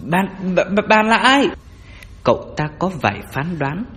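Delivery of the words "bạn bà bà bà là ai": loud, high, stuttering challenge; frightened stammer. Acted. Grave robber demanding who the ghostly woman is, frightened stammer. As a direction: stammer it out in raised alarm.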